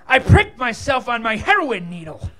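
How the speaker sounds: ordinary talk, nothing marked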